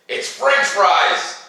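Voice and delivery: high, slow, drawn-out exclamation; building excitement for a class of small kids; excited reveal. Acted. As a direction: announce it like a delightful surprise. Guessing kids' favorite potato.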